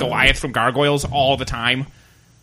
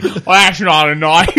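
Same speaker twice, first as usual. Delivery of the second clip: lightly put-on Australian drawl, slowed; a hint of Aussie Crocodile Dundee; 4 dB louder, slower than usual. Joking Australian accent at the podcast friends. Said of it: "doing a Crocodile Dundee impression".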